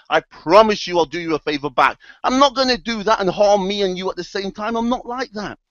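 insistent mock-sincere assurance; earnest promising. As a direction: swear blind you can be trusted. Scorpion promises frog no harm.

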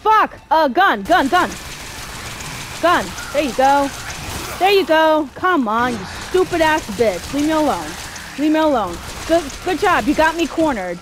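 loud, high-pitched cursing under attack; real flustered, furious outburst; angry panic. Real, not acted. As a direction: yell at the enemy while cornered. Cornered and cursing during game fight.

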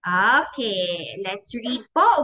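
normal talking voice

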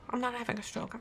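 very soft, drained voice; faint mumble. Real, not acted. Gamer reassuring audience after failing, faint mumble. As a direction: barely get the words out.

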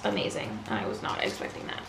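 soft whispered awe; awed whisper. Real, not acted. Collector whispering to camera in awe. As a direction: breathe it out in disbelief. Hushed aside after surprise.

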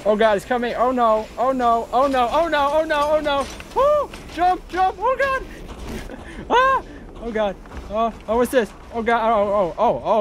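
high panicked oh no babble; shrieking in real terror; terrified gibbering. Real, not acted. Chased by monster, gibbering.

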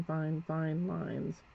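"fine, fine lines" stretched very slowly; slow right down on "fine, fine lines" as the brush drags; drawn out slowly. Shown as a demonstration. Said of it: "I'll just accent".

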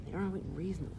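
hushed echo of "reasonably"; quiet repeat. Shown as a demonstration. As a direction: murmur it back to yourself. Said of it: "Like Hemingway's".

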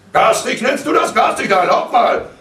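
high, loud, outraged retort; worked-up father shouting; heated indignation. A narrator's reading. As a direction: throw the word back indignantly. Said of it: "ereiferte sich der Vater".

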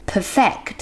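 "perfect" stressed on FECT; stress on second syllable. Shown as a demonstration. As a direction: land on the last syllable of "perfect". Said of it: "stress ที่ข้างหลังแทน". (เน้นเสียงพยางค์ท้ายของ "perfect")

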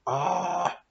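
long, high "aah"; imitated voice's cry. Man retelling the voice he heard, high wordless cry. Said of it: "I heard this voice go like".

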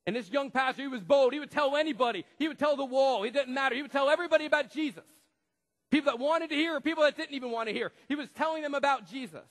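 loud, high, driving preacher voice; fiery pulpit preaching; full-force preaching. Acted. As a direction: preach it out to the back row, building fire. Preaching about the bold pastor.

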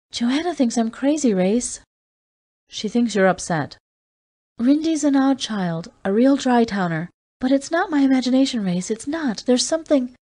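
a touch of desperate Julie; pleading Julie. A narrator's reading. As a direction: insist she isn't imagining it.

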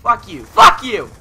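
high, loud curse building to a yell; angry cursing. Real, not acted. Gamer to the players taking the objective, furious building yell.

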